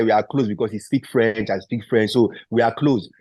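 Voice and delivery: ordinary talk, nothing marked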